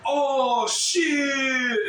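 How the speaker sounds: loud, high shocked "Oh, shit"; crowd's shocked yell. Retold or imitated. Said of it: "everybody's like".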